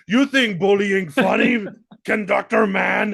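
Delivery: high, loud, slow taunting shout; mock angry fighter. Fighter taunting the conductor he beats, in a loud high shout. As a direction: yell it down at the beaten conductor. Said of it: "Armin beating the shit out of".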